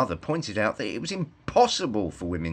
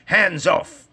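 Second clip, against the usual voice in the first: loud, higher shout of Hands off; crying out; 9 semitones higher, 6 dB louder than usual. A narrator's reading. Woodchopper bursting in, shouting at the wolf. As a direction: bark it like a rescuer bursting in. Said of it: "cried the woodchopper".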